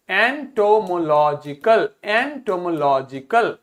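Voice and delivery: एंटोमोलॉजिकल slowly, syllable by syllable; pronunciation demo. Shown as a demonstration. Teacher drilling the adjective with pupils, slow syllabic एंटोमोलॉजिकल. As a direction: spread out each syllable of एंटोमोलॉजिकल. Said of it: "pronunciation सीख लेते हैं".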